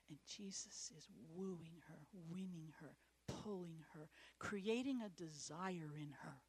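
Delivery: soft unvoiced whisper, tender; hushed whisper. Real, not acted. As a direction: breathe it to the church like a secret. Tender whisper about Jesus.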